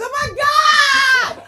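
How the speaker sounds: screaming high and very loud, slowly; ecstatic scream. Retold or imitated. Fan spotting [name] at a memorial, screaming. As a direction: shriek in star-struck delight. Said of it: "I said".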